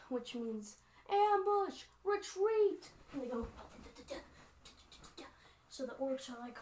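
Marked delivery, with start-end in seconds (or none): 1.1-2.9 high, loud alarm call; yelled warning. Acted. Elves warn their own of an ambush, yelled warning. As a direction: shout the alarm to the whole troop. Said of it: "What do the elves yell out".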